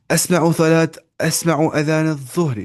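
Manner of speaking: announcing example. Real, not acted. Teacher to class, loudly starting the next sentence. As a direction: call out the new example to the class.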